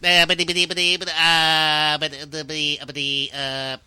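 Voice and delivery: a second try at Porky Pig; Porky Pig stutter. Acted. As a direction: stutter on every word like Porky. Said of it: "I used to be able to do Porky".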